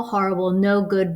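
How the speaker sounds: ordinary delivery, nothing marked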